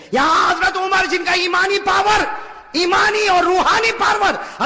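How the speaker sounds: high, loud impassioned preaching; preaching at full force. Real, not acted. Preacher at the pulpit, loud and impassioned. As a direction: fire up the congregation.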